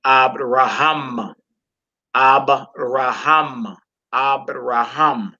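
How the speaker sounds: "Abraham" drawn out slowly, weight on final "ham"; last-syllable stress. Shown as a demonstration. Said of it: "pronounced with the accent on the last syllable".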